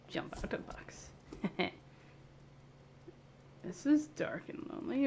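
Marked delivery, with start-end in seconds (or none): 0.1-1.1 mock secret whisper. Playing a game, whispering the echo back to himself. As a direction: breathe it like a secret reply.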